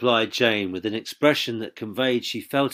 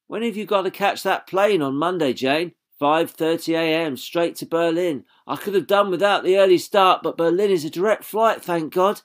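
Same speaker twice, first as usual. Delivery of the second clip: Jane's voice; 8 semitones higher, 4 dB louder than usual. A narrator's reading. Jane and Bob dialogue voiced high.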